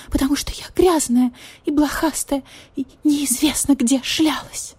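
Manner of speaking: pleading, sorry for herself; pitiful cat lady. Acted. Marquise voiced higher throughout.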